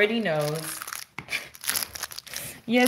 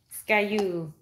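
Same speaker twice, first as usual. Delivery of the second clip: ordinary talk, nothing marked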